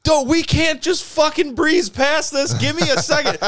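hammed-up disbelief, stretched long; Todd freaking out. Friend hearing about new teeth, shocked high yelp. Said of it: "He's like".

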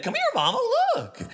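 high, eager little chicken pleading; squeaky chicken voice. Acted. The chicken urging the players inside, high and squeaky. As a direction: pitch it way up, urgent and small. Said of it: "And it's kinda jerking its head".

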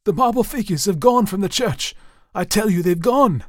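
high, loud, panicked insistence; frantic. A narrator's reading. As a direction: insist desperately on being believed. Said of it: "a little less frantic for his laughter".

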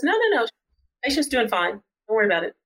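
high sing-out reassurance, easing down; imitated brush-off. Nurse telling the doctor patient is fine, imitated brush-off.

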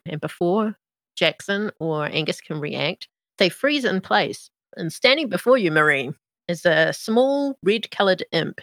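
low, even scene-setting narration; game master narration. A narrator's reading. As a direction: set the scene calmly.

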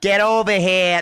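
mock Boston accent, R's dropped. Shown as a demonstration. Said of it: "nothing sexy about that accent".

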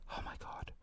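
barely audible whisper; hushed, fed-up aside; whispered exasperation. Real, not acted. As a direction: breathe it out in exasperation. Exasperated aside after rant.

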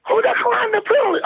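high, insistent demand; indignant demand. Retold or imitated. Demanding the rookie referee explain the declined penalty. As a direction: demand an answer.

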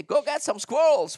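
calling the dog. Retold or imitated. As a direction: yell it out to send the dog. Owner commands dog to hunt.

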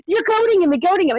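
high-pitched mock alarm; lightly acted panic; imitated freak-out. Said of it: "like, freaking out".

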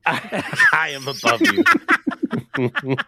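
slow, grand, laughing tyrant; tyrannical. Acted. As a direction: lord it over them, chuckling. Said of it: "That sounded very tyrannical".

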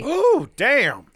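high, loud ooh of shock; surprised. Real, not acted. Podcast cohost hears about fifteenth-century penis theft, loud surprised ooh. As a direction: gasp out a shocked damn.

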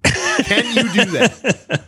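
slow, high laughing question. Real, not acted. Excited friend laughing while pitching the elevator idea. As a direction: drag it out through a laugh.

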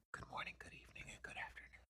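hushed whispered greeting; ASMR whisper. Pretend. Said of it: "whole show like that".

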